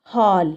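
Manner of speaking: हॉल with an open rounded aw, like English hall; English aw vowel. Shown as a demonstration. Teacher showing pupils the English vowel in हॉल. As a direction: say हॉल as hawl, not haal. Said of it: "जैसे अब हम पढ़ते हैं".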